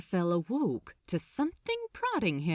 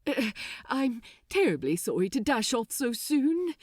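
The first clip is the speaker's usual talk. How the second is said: flustered apology; 5 semitones higher, 3 dB louder than usual. Acted. Aziraphale excusing himself.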